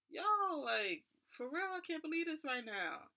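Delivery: can't believe your bad luck; stranded traveller reacting to no flights, in disbelief. No flight, money short.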